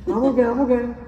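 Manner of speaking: hammed-up pretend fine voice; mock denial. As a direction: squeak out a fake brave face. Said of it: "but you're like".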